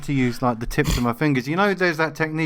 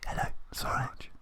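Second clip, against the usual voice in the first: hushed, unvoiced mock-ASMR whisper; 2 semitones higher, 9 dB quieter than usual. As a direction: whisper right into the mic to tease. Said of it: "I'm gonna whisper at the end".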